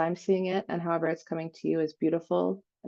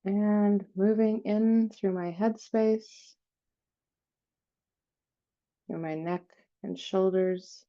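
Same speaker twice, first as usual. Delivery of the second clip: guided meditation; 3 dB quieter than usual. Real, not acted. Host leading listeners through an energy cleansing, guided meditation. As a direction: softly guide them down through the body, phrase by phrase.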